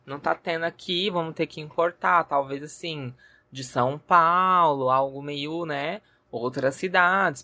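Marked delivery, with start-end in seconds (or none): none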